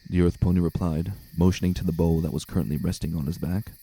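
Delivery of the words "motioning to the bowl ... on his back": usual speaking voice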